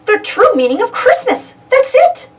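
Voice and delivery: high, rising eureka cry; the light bulb moment; excited realization. A narrator's reading. Donald realizing what Christmas means, high and excited. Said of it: "Donald exclaimed".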